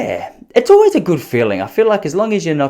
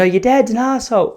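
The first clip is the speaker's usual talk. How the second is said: high, blunt mum voice; mock mum; 9 semitones higher than usual. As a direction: blurt it like a bitter mum. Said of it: "She never said that".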